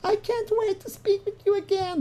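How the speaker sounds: very high, put-on girlish voice; mock girl in love. Imitating what a loving girl would say, high lovesick voice. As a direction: gush it in a tiny high falsetto.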